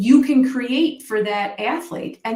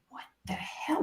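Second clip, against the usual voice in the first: stunned whisper under the breath; whispered thought; 6 semitones higher, 12 dB quieter than usual. Retold or imitated. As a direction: hiss it to yourself in disbelief. Remembered angry thought as kid.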